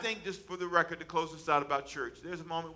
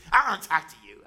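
louder, huffy put-on voice of an offended woman; mock angry lady; 9 dB louder than usual. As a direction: snap it out like a cross churchgoer. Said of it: "She was so mad with me".

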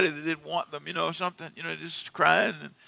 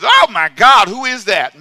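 loud, higher amazed exclamation; mock amazed; 5 semitones higher, 13 dB louder, slower than usual. Preacher imitating amazed funeral guests, loud and higher. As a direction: gasp it out like an amazed mourner. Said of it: "and they was".

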